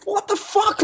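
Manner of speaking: very high-pitched, squawking disbelief; incredulous outburst. Real, not acted. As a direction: blurt it out high, utterly baffled. Baffled outburst in his rant.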